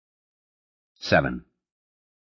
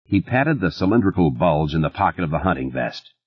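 ordinary delivery

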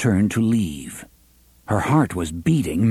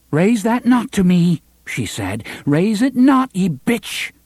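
higher, louder, fierce warning; angry defiance; 9 semitones higher, 4 dB louder than usual. Acted. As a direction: warn her off, hard and high. Susan defies her aunt.

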